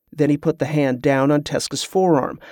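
ordinary delivery

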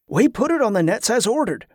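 high-pitched subordinate voice; earnest underling defending himself; defensive sailor. A narrator's reading.

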